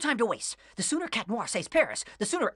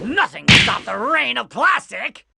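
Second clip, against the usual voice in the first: villain boast; 3 semitones higher, 7 dB louder than usual. Acted.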